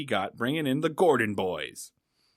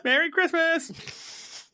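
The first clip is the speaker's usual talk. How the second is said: high, slow, sung-out greeting; mock festive cheer; 12 semitones higher, slower than usual. Co-host mocking the Christmas jailbreak, high, sung-out cheer. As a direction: cry it out like a gleeful holiday host.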